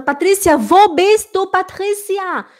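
calling to Patrícia. Acted. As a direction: call out for her in the house. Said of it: "eu olho pra Patrícia e falo".

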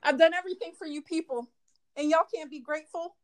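mock indignation. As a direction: whine about ungrateful people, overdone. Mock offended lady continues.

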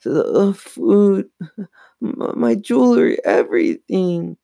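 high, stammering near-wail; trembling, distressed. A narrator's reading. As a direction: stutter and stretch the words in distress. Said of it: "Chris said in a trembling voice".

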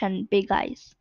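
ordinary delivery, nothing marked

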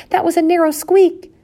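high, loud exclaiming; relieved husband. A narrator's reading. Husband survived the owl's dive, relieved. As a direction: exclaim it high after a scare. Husband describes narrow escape.

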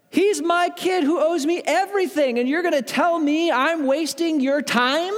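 high-pitched, hammed-up indignation; offended. Father retelling how his own kid offended him, mock-outraged. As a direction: sound outraged at the sheer nerve, stretching key words. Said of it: "I was offended".

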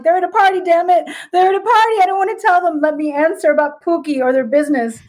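high, pleading argument; hammed-up frustrated pleading; exasperated protest. Argues with spirit guides, acted.

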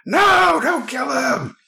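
loud, very high cry from behind; desperate shout. Acted. Hidden man pleading for bear's life, desperate high shout. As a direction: yell it out to stop a killing. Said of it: "you hear from behind you".

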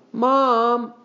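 put-on high, drawn-out boy's wail. A narrator's reading. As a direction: wail in protest to Mom. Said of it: "wailed Henry".

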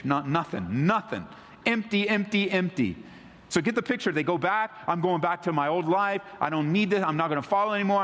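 high, ringing pulpit delivery; fervent sermon voice lifted high; preaching at full force. Acted. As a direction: preach it out to the whole church. Preacher builds the fishing story.

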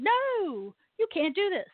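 high-pitched mock scolding; stern "no" in a high, put-on voice; mock prohibition. Voices the Church forbidding pagans.

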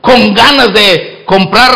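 ordinary talk, nothing marked

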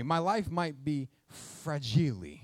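"fragile" said fra-GEE-lee with a final ee; mock Italian fragile. Shown as a demonstration. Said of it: "That's a Christmas story".

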